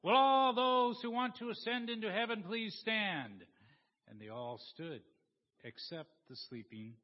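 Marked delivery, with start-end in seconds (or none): 0.0-3.4 loud, high call to the congregation; preaching minister. Acted. Minister asks the congregation to stand, calling out loudly. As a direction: project it loudly, well above normal pitch. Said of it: "The minister got to the point in her sermon where she said".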